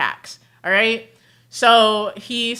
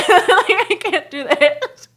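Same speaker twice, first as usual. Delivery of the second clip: laughing; 8 semitones higher, 3 dB louder, slower than usual. Real, not acted.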